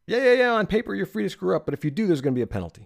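high, loud, dismissive mock voice; mocking sarcastic. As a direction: brush it off in a sarcastic singsong. Mocking the company's fake permission.

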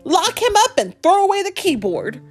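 acted cry of outrage. A narrator's reading. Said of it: "cried B".

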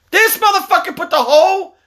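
high, incredulous yelling; outraged complaint. Real, not acted. Parent telling on kid who took the food, outraged complaint. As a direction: protest high and loud.

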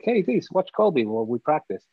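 a hint of a bossy coach; imitating the coach. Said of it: "and you were like".

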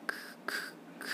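ク whispered with no voice, throat still; unvoiced ku demo. Shown as a demonstration. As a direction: breathe out a bare ク with no vowel. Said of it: "喉は震わさなくてもクって出ます". (喉を震わせずにささやく「ク」)

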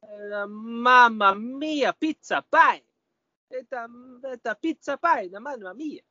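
hammed-up Italian accent, high and slow; Italian chef voice; jolly Italian pizza chef. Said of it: "He's gonna feel Mario".

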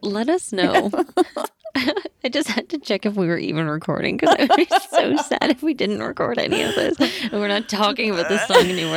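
fast, giggling through the words; laughing while talking. Real, not acted. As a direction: laugh as you rush it out. Laughing through rushed lines.

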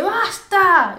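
sharp, high, loud basta; retold shout. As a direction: yell it to stop the fight. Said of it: "Jane grita".

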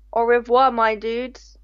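ordinary talk

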